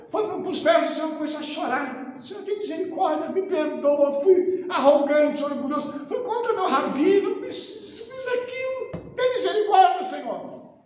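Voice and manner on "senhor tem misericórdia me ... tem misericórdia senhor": pleading high and breaking, like a man in tears; tearful plea. Acted. The ruined man crying out to the Lord for mercy. Man weeps at the Lord's feet.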